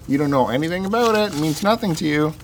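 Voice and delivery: ordinary delivery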